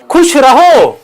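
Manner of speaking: खुश रहो high and stretched out; emphatic exhortation. Real, not acted. Speaker giving audience the better option, emphatic exhortation. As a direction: drive खुश रहो home, slow and high.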